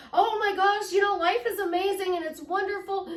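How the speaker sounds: high, loud, elated stage voice; Oprah Winfrey. Acted. Talk-show host on stage telling a story, elated. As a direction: gush to the audience about wonderful life. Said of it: "she will change her voice to draw you".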